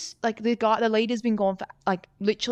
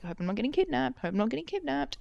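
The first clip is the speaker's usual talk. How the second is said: higher, softer worried self-talk; small, high voice of mock-anxious thoughts; anxious inner voice; 5 semitones higher, 6 dB quieter than usual. Rider fretting to themselves on the bush trail, anxiously. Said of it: "where my anxiety took me".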